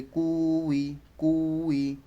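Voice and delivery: "কুই" with a long held "কু"; slow, elongated "কুই"; drawn-out vowel. Shown as a demonstration. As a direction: stretch the first vowel of "কুই". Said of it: "এগুলো টান দিয়ে পড়তে হবে".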